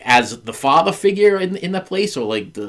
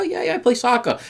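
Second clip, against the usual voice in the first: higher-pitched imitation of a kid answering; lightly voiced child; 11 semitones higher than usual. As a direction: lift the pitch to mimic a small boy. Teacher voices the child answering him.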